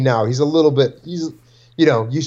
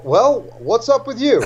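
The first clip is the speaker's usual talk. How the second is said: mock dad reply; 9 semitones higher than usual. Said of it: "And I go".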